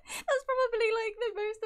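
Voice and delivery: high-pitched, laughing through the words; real laughing. Real, not acted. Opener delighted by a Vaporeon, laughing as they talk.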